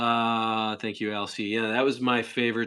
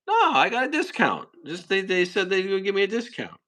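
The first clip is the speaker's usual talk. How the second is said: lightly voiced high reply; high Jackie voice; 9 semitones higher than usual. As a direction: squeak out the excuse.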